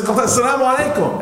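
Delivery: high, pleading cry of greeting; crying plea. Retold or imitated. Ka'b pleading with silent cousin Qatada, high tearful cry. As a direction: call out to the cousin, near tears. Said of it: "And he's crying".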